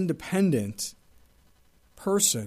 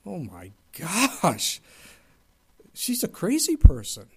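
shocked reaction; 4 dB louder, slower than usual. Retold or imitated. Reacting to her interview.

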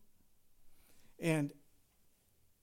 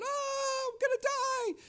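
put-on high-pitched terrified child's scream; panicked scream; 3 dB louder, slower than usual. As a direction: imitate a boy screaming in panic on a snowmobile. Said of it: "He is screaming and yelling".

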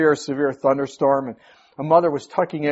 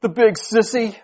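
put-on high, louder little boy pouting; sulky kid muttering an insult; shaky child's voice; 8 semitones higher, 6 dB louder than usual. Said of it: "a shaky voice said".

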